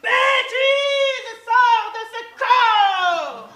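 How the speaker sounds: loud, slow shouted command. Acted. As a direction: bellow it like an exorcism. Exorcising the friend's madness.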